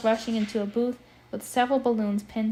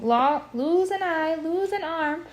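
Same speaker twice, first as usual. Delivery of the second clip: higher, slow squawky parrot; a parrot shrieking its warning; 6 semitones higher, slower than usual. Acted. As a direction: screech it like a talking parrot. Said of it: "A parrot shrieked".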